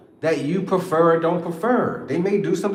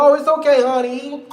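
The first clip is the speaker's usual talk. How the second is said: mock forgiving partner; 6 semitones higher, 4 dB louder than usual. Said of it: "you just supposed to be like".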